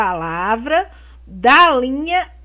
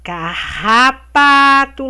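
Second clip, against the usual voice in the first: slow syllable reading; 5 dB louder, slower than usual. Shown as a demonstration. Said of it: "vocês vão separar a sílaba aqui".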